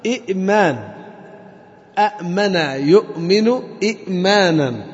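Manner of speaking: إئمان and أأمن يؤمن إئمانا said slowly with every hamza sounded; original hamza forms. Shown as a demonstration. Teacher slowly recites the original forms to the class. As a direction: spell out each hamza in إئمان carefully. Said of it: "أصلها".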